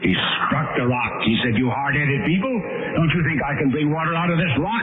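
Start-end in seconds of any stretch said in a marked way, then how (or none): 1.6-4.8 high, rising sermon delivery; impassioned preaching. Acted. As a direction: preach it with mounting insistence.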